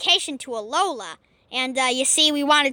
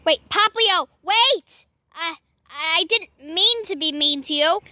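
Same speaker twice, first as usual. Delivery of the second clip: normal talking voice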